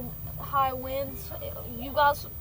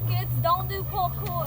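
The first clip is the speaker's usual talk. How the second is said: a kid's mock Australian warning, a bit higher; lightly done Australian accent; put-on Australian accent; 5 semitones higher, 4 dB louder than usual. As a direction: play an Aussie bloke warning the kids. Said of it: "He has this Australian voice".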